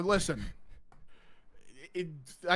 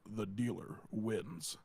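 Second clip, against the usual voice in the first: ominous announcer; 7 semitones lower, 11 dB quieter, much slower than usual. Retold or imitated. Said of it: "and goes".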